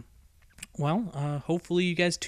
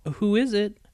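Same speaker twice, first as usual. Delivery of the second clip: higher, louder call through a door; 6 semitones higher, 5 dB louder than usual. A narrator's reading. Twilight calling to a knock at her door, louder.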